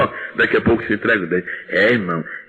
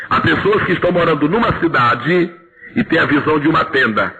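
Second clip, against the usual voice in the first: usual speaking voice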